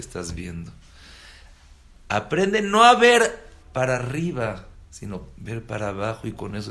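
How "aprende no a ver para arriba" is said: loud exhortation. Acted. Preacher exhorting the congregation.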